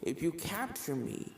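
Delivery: a creepy calm killer ghost toying with her prey; ghost Jack. Acted. Ghost killer explains herself to the filly, softly menacing.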